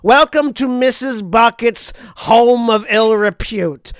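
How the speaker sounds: put-on welcoming visitors in a broad Boston-Jersey accent; Boston Jersey mom hostess voice. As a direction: play a brassy Boston-Jersey mother at the door. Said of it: "Boston Jersey mom".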